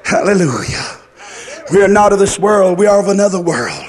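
ordinary delivery

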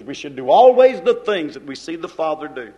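ordinary talk, nothing marked

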